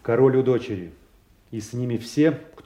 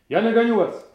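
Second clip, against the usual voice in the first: raised, high call after someone; 9 semitones higher, 6 dB louder than usual. Acted. Telling departing allies he will catch up, calling out. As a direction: call it out to people walking away.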